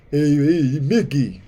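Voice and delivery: a light Rocky-style Stallone call; quick Rocky calling his coach. Shown as a demonstration. Showing viewers how to do Stallone, lightly voiced Rocky call. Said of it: "You just go".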